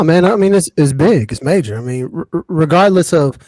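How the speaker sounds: normal talking voice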